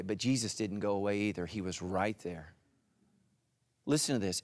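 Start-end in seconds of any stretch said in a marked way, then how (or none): 0.0-2.5 hushed testimony. Real, not acted. Intimate covid testimony.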